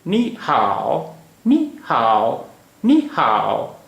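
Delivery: slow नी हाओ, each word drawn out; Mandarin tone demonstration. Shown as a demonstration. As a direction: say नी हाओ slowly with exaggerated tones. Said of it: "की आवाज़ सिर्फ ऊपर से नीचे आ रही है".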